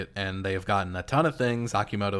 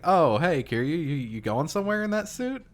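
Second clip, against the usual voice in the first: higher, bright Ned Flanders-style neighbor greeting; lightly voiced friendly neighbor, pitched up; cheery neighbor; 6 semitones higher than usual. Neighbor voiced in retold scene.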